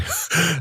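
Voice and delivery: put-on high, wheezy old man's cackle; grotesque cackle. A narrator's reading. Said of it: "fell to cackling grotesquely".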